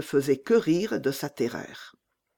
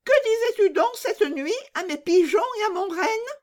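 put-on bold robber girl. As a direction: toss it off like a fearless bandit girl. Robber girl questions Gerda.

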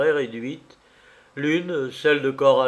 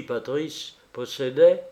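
ordinary delivery, nothing marked

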